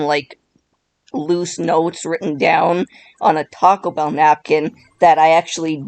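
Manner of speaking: ordinary delivery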